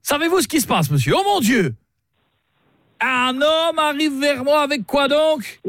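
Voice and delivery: hammed-up alarm at a man coming with a shovel; hammed-up outrage. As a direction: play scandalised gentleman. Caller feigning outrage and alarm.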